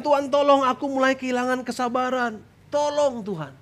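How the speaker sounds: high-pitched cry for help; pleading acted prayer. Believer losing patience calls on God, high urgent plea. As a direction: implore God as patience runs out.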